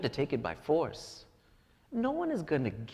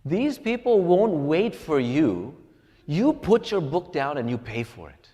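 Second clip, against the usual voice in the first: dad's yell; 8 dB louder than usual. Retold or imitated. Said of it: "he yells back so that everyone else can hear".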